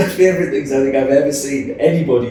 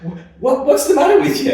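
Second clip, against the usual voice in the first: high, laughing mock alarm; laughing, recalling being baffled by a friend's pre-gig babble; mock bewildered squeal; 14 semitones higher, 6 dB louder than usual. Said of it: "I was like".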